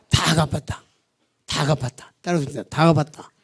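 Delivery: ordinary talk, nothing marked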